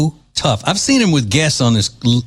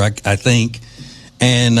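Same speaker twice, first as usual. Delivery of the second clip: ordinary delivery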